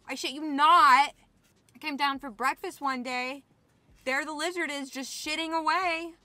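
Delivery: loud, worked-up venting about roommates; indignant, loud storytelling; exasperated rant. Real, not acted. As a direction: raise your voice in disbelief, punching the phrases. Ranting about roommates' pets.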